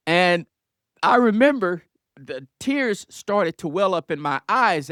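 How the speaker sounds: ordinary talk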